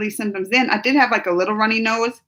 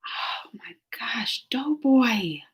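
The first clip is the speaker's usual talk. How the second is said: slow, hushed whisper of real shock; stunned whisper; 7 dB quieter, much slower than usual. Real, not acted. Reacts to Doughboy's neck being cut open, whispering in shock. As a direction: breathe it out slowly, overwhelmed.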